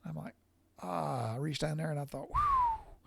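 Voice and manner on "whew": pained whew. Retold or imitated. Feeling the sore incision.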